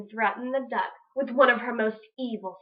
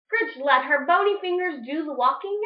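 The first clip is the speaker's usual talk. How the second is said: plain reading voice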